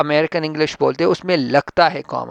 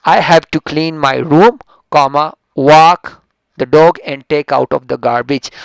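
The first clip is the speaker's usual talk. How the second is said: very slow and loud, each phrase set apart; slow dictation; 6 dB louder, much slower than usual. Shown as a demonstration. Teacher reading example sentence, slow and loud. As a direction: read it slowly so they hear each comma. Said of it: "reading example sentence to class".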